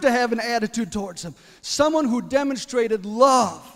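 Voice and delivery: loud, rising sermon climax; full-force preaching. Acted. Preacher praising the neighbor who loved his grandmother, full-force preaching. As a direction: preach it out to the congregation.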